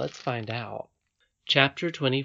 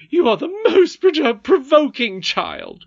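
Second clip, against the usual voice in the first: loud, high, spluttering nurse; exasperated cry; 11 dB louder, slower than usual. Acted. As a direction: cry out at a maddening child. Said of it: "cried her nurse".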